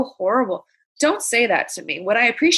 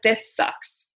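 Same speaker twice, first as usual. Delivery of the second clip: blunt; 3 dB quieter than usual. Retold or imitated. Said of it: "I don't sugarcoat it".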